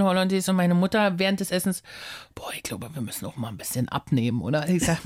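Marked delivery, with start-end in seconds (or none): 2.3-4.7 low, hushed mother's voice, much softer; a mother's low, quiet grumble over dinner; mother's low aside. Retold or imitated. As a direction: drop low and quiet like mum muttering at the table.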